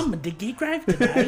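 high-pitched silly British voice; mock British accent. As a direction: badly fake a British gravedigger. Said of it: "that's not gonna work".

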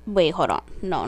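ordinary talk

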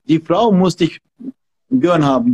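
ordinary talk

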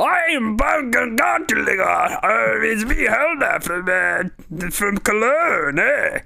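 high, slow, garbled mock-German holler; hammed-up, near-unintelligible German accent. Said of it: "Is that supposed to be German?".

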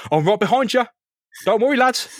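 breezy, high reassurance from overhead; high mock-helpful voice; high-voiced Spider-Man. Spider-Man calls to thugs from above.

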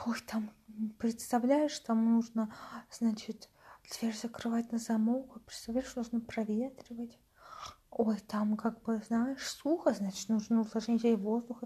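надменно шептать, поучая; тихо и свысока, как важная мать; высокомерный шёпот. Retold or imitated. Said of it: "Она такая". (мать поучает, высокомерным шёпотом)

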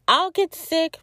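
high, mocking little squeak; playful high-pitched self-mockery. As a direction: squeak it out like teasing yourself. Mock self-correction in squeaky voice.

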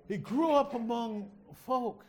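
ordinary talk, nothing marked

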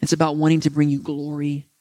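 earnest closing prayer to the Father; preacher praying over his listeners. Real, not acted. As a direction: pray sincerely, unhurried.

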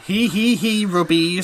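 slow, loud cutesy purring; hammed-up baby talk. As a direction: coo it like a smitten pet owner. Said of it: "read these out in a voice".

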